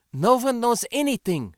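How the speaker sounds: high, loud, tense pronouncement; irritated and fearful. A narrator's reading. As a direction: declare it, rattled and annoyed. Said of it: "in irritation and fear".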